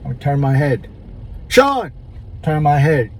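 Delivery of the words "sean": a high called-out "Sean"; calling out a name. Retold or imitated. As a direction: call "Sean" to get his attention. People calling out his names.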